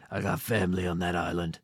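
low-voiced action-movie tough guy; Dom Toretto. Host imagining the film hero showing up, low-voiced impression. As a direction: drop the pitch low for a mock movie hero. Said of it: "Dom Toretto from Fast and Furious shows up and he's like".

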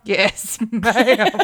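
"Yes, ma'am" dissolving into laughter; giggling mid-phrase; laughing. Real, not acted. Imitating airport clerk, cracking up.

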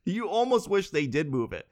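ordinary talk

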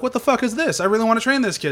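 indignant, pushing back hard; outraged Qui-Gon. Retold or imitated. Qui-Gon protesting to the Jedi council, in high loud mock outrage.

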